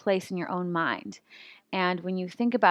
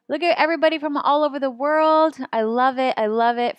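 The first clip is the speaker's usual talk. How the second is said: delighted; 5 semitones higher, 5 dB louder than usual. Real, not acted. Viewers greeting from worldwide.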